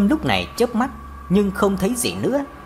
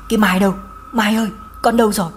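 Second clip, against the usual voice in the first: a half-held mother's wail, rushing; wailing cry; 2 semitones higher, faster than usual. Acted. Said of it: "gào lên". (người mẹ gào gọi con gái, gấp gáp)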